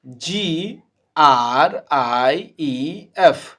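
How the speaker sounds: slow, deliberate letter-by-letter spelling for the class; teacher spelling a word slowly to students. Shown as a demonstration. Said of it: "अब आइए".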